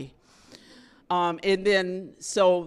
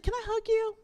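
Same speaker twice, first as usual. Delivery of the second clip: sweet high voice; faster than usual. Retold or imitated. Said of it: "she's like".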